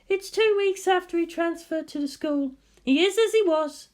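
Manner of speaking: high, lively woman's voice; bright teasing guardian; Misato voice. Acted. Misato questions Shinji at the target, high lively voice.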